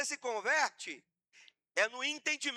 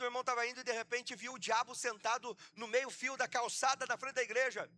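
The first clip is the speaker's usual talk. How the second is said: ordinary talk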